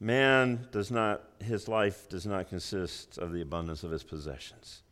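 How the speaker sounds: grave, near-whispered emphasis; hushed solemn repeat. Real, not acted. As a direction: let the verse sink in quietly. Solemn repeat of key verse.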